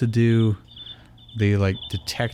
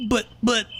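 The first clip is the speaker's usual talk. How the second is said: flustered stammer; 12 semitones higher than usual. A narrator's reading. Iris stammers.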